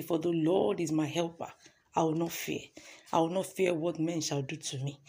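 quiet, measured scripture reading; hushed scripture recital; scripture recitation. Acted.

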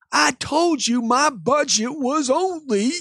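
drawn-out, high-pitched mad protest; hammed-up outburst, stretching the words; upset customer. Customer complaining to salesman about his budget, upset. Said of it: "Is he mad at you".

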